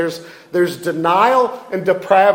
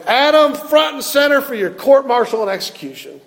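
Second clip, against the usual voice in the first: mock angry yell; 7 semitones higher than usual. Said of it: "in anger yelling".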